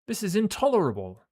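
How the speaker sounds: put-on high, loud, indignant British accent; British-accented character. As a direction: put on a British officer's outraged exclamation. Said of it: "accent became that".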